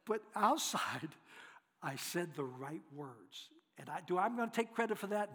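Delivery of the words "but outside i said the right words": usual speaking voice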